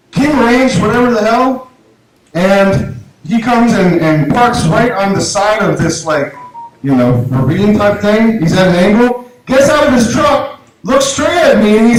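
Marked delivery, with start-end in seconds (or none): none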